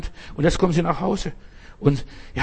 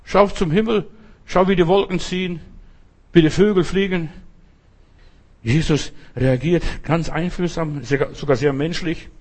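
usual speaking voice

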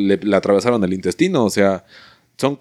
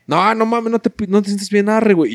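friend's alarmed voice; 9 semitones higher than usual. Retold or imitated. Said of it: "luego llegó y dijo".

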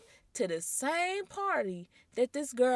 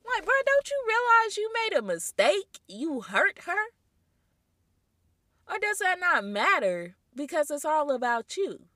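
scolding; 5 semitones higher, 6 dB louder than usual. Real, not acted. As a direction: lay into him for his selfishness. Scolding the cheating boyfriend.

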